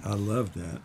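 usual speaking voice

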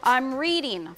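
usual speaking voice

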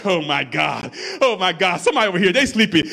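ordinary delivery

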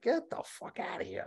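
high, soft, unvoiced dismissal; scoffing whisper. Scrolling boring Instagram posts, he whispers a scoff. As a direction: hiss it in disbelief.

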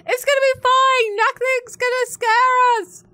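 loud, high, pumped-up pep talk; forced bravado. Acted. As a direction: psych yourself up, loudly. Forced bravado before the dark corridor.

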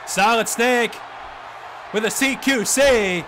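rising hype on the big move; wrestling announcer calling a big counter with excitement; excited call. Acted. Big move called in commentary.

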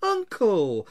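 high, loud appeal; pleading. A narrator's reading. Nephew appealing to his ranting uncle, pleading. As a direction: plead with him in one drawn word. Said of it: "pleaded the nephew".

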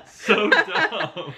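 high laugh, words through it. Real, not acted. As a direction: laugh as you say it. Laughing at wife's request.

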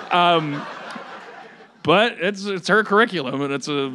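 ordinary delivery

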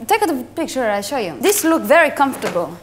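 lightly put-on Italian accent; broken English with an Italian lilt. Italian pasta boss scouting a model, Italian accent.

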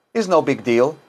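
ordinary talk, nothing marked

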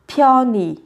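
pyoni ending in an "ee" vowel. Shown as a demonstration. Teacher repeating the second pronunciation for learners, pyoni ending in "ee". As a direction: finish pyoni on a clear "nee". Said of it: "biến đổi ươi thành y".

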